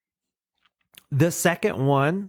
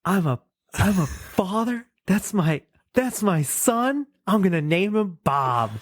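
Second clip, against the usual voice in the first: much higher, giddy caveman voice; delighted surprise; 8 semitones higher than usual. Retold or imitated. Caveman learning he fathered the fire, in a much higher, giddy surprised voice. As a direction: gush like a caveman who just learned he's a dad. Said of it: "And he goes".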